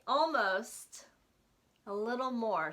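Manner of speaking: usual speaking voice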